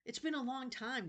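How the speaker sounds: put-on high, eerie old man's voice; a wheedling ancient keeper of books; creepy old librarian. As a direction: play a strange, eager old librarian. Librarian character voice.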